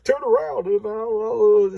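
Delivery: high, squeaky mock-shy guy; shy boy. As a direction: ham up a bashful boy who won't undress. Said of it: "And he's like".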